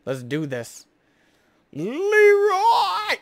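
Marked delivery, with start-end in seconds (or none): 1.7-3.2 high, loud drawn-out charge yell; battle cry. Pretend. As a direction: bellow it like charging in.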